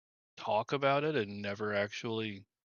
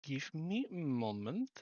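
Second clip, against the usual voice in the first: slow, hammed-up Russian accent; mock Russian accent; 4 semitones higher, 3 dB quieter, slower than usual. Streamer joking while reading, in a slow mock Russian accent. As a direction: drawl it out in a joke Russian accent. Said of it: "needed to do that in a Russian accent".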